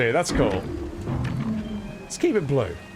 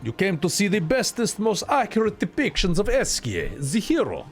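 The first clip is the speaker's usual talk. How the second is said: higher, hammed-up amateur Russian accent; bad Russian accent; 9 semitones higher than usual. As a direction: read it grandly in a badly faked Russian accent. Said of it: "I got a bad Russian accent".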